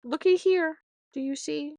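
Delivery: insistent mom. Retold or imitated. Mom showing daughter the bleeding heel, insistent. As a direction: make her look at the heel. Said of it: "And I'm like".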